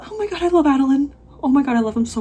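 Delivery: high, loud gush of love; real fangirl gush; gushing squeal. Real, not acted. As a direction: squeal it out like a fan. Gushing over character.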